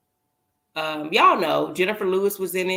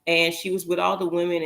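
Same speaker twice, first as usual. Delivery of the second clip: ordinary delivery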